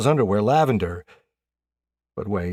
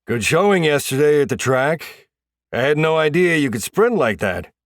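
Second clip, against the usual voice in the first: louder, higher coach's praise; head coach; 7 semitones higher, 7 dB louder than usual. A narrator's reading. Head coach commends player's sprint, loud projecting coach voice.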